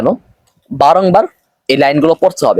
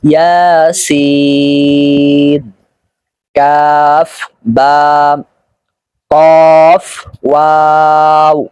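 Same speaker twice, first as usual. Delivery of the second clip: very slow, each letter name stretched; drawn-out letters; much slower than usual. Shown as a demonstration. Teacher stretching letter names for students. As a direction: hold every vowel long and even. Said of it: "টান সহকারে বলতেছি".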